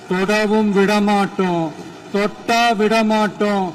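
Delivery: loud, rising rally cry; forceful political warning, building in pitch; emphatic vow. Real, not acted. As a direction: hammer each refusal out to the crowd.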